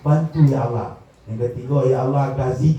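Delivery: acted detailed prayer. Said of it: "gitu doa itu cek-cek".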